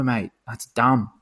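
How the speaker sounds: ordinary delivery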